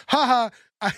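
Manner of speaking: high, teasing put-on gloat; mock taunt. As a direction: imitate a girl showing off. Voices pretty girl taunting another.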